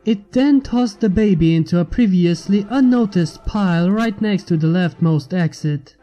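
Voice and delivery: plain reading voice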